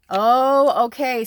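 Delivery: loud high startled oh; surprised. Real, not acted. Reacting to a message from Spirit, surprised and loud. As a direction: gasp it out loud.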